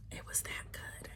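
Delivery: hushed awe; whisper. Real, not acted. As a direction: whisper it like a secret. Gushing about the book.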